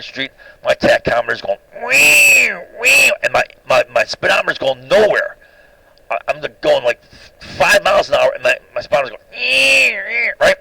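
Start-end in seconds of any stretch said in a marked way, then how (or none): 1.9-3.1 high squealing whine of a revving engine. Retold or imitated. Imitating his tachometer racing while the car crawls, high whine. 9.4-10.3 engine whine. Retold or imitated. Imitating the speedometer going nowhere.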